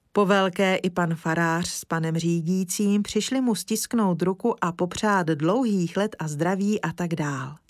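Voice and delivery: ordinary delivery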